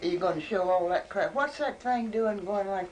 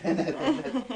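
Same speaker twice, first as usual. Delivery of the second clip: a laughing, brushed-off nothing; 5 semitones higher than usual. Real, not acted. Grandma brushing off her odd remark, laughing.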